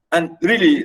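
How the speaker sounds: ordinary talk, nothing marked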